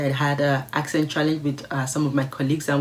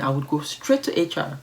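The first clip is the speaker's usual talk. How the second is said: ordinary delivery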